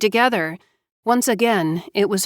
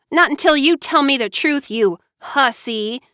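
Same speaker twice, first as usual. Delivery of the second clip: enraged; 6 semitones higher than usual. A narrator's reading. Enraged sister accuses.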